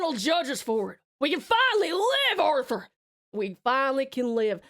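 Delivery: high, rousing Western schemer's plea; impassioned outlaw leader. Acted. Outlaw pitching Arthur a plan for Tahiti, hammed-up fervor. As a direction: sell the grand escape with hammed-up fervor.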